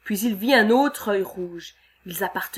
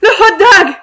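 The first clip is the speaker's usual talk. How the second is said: much higher, very loud scream of terror; terrified scream; 12 semitones higher, 18 dB louder than usual. Acted. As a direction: scream the monster's name. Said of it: "Ole Swenson hurla".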